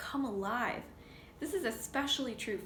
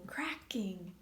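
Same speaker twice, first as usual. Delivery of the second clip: soft suspenseful whisper; hushed egg-cracking whisper; 3 semitones lower, 3 dB quieter than usual. Acted. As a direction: whisper it like a secret.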